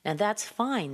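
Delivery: normal talking voice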